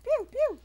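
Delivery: loud, high-pitched mimicked zaps; two falling toy-blaster shots; playful laser pews. Player mimicking shots in a game, loud high pews.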